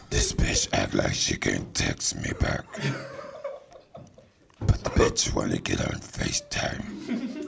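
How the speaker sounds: very low sinister growl; creepy horror villain complaining; Candyman. Pretend. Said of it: "Oh, you mean Candyman?".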